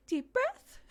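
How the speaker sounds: very high questioning little voice; a toddler echoing her mum; high piping toddler echo; imitating toddler. Toddler repeating deep breath.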